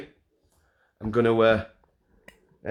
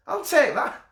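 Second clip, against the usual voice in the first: delighted squeal. Real, not acted. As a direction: squeal with glee at the compliment. Delighted by a funny insult.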